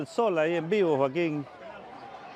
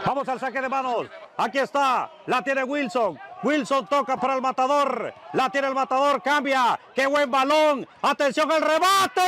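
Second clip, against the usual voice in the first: building match commentary; 5 semitones higher than usual. Acted. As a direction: build the excitement as the attack develops. Building play-by-play to a goal.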